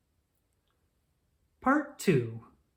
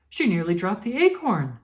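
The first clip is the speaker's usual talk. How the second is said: ordinary delivery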